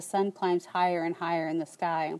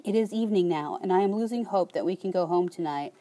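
plain reading voice

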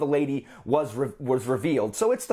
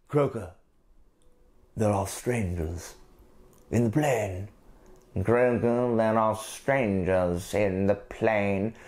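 much lower, slow, ominous rock voice; deep stone voice; 8 semitones lower, slower than usual. Retold or imitated. Reviewer voices the menhirs as slow deep stones. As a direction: drag it out like an ancient stone. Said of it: "whatever other terrible accent I can do".

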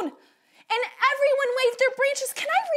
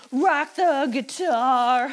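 low, slow rocker voice; rock singer; 7 semitones lower, 3 dB louder, slower than usual. Pretend. Teacher rocking out on guitar with children, playful rocker voice. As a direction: drag it out low like a rock frontman.

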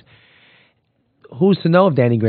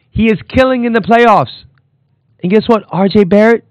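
higher and louder, fired up; excited hype; 9 semitones higher, 5 dB louder than usual. Real, not acted. Podcaster hyping R J Barrett to listeners, excited. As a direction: shout the praise like a fan.

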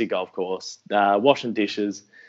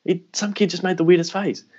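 much higher, puzzled and half-laughing; baffled and high; 9 semitones higher than usual. Retold or imitated. Telling his mate Jordo about the kid, baffled and high-pitched. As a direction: act out your own confusion, pitched way up.

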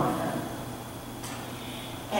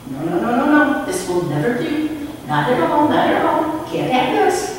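rising, louder playful tut-tutting; a clown refusing to accept a sad face; mock-stern clown lady; 6 semitones higher, 4 dB louder than usual. Clown lady scolding the sad boy, playful and rising.

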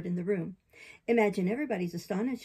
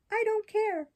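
high-pitched puppet defiance; defiant puppet; 13 semitones higher, 4 dB louder, slower than usual. Acted. Puppet answering the fairy's warning, defiant.

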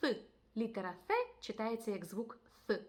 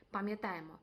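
usual speaking voice

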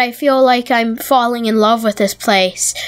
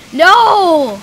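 loud cry of dismay; dismayed shout; 6 semitones higher, 4 dB louder, slower than usual. Real, not acted. Player fearing the plot is sold, loud dismayed no. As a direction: shout no at the bad news.

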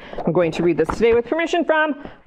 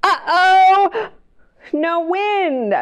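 dramatic uh-oh; 9 semitones higher, much slower than usual. Acted. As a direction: play up the disappointment. Kite won't fly.

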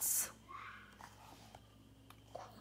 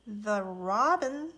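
ordinary delivery, nothing marked

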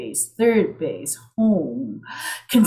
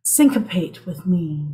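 ordinary delivery

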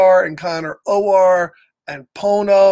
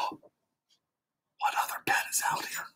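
unvoiced whisper, much softer; hushed suspense; 14 dB quieter than usual. Reader wonders what pet comes next, hushed whisper.

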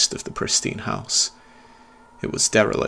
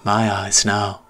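put-on menacing ghost boy; slower than usual. Ghost boy claiming the narrator's eyes, slow sinister. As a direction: savor each word with menace.

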